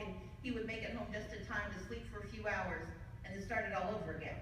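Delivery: ordinary delivery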